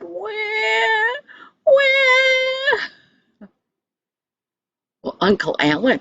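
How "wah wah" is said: put-on high-pitched baby doll wah wah; doll crying. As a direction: cry like a little doll, much higher. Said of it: "cried real tears when she said".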